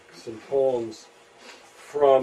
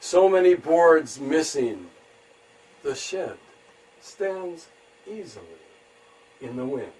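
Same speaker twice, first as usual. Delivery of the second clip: haiku reading; 4 semitones higher, 5 dB louder than usual. Acted. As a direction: let each phrase hang, then drop to a hush. Reads own haiku.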